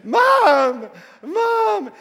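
long, high hollers for a missing mother; imitated desperate screaming. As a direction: call through the house like a desperate kid. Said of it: "they start screaming".

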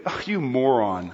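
low groan of mock disgust; put-on exasperation, played for laughs; mock scolding leader. Group leader reacting to a shared word, mock exasperation. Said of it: "what's the leader gonna do".

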